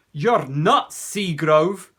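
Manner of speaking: loud sneering bully voice; a boy blurting an insult; bully's retort. A narrator's reading. Bully Billy yells at Jonathan. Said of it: "was all that he could find to say".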